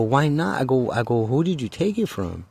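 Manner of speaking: high, urgent questions; urgent pressing. Retold or imitated. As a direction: press him hard for answers. Pressing the kid.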